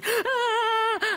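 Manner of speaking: high crying wail; sob. Acted. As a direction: let out a child's wail. Crying North Korea pupil.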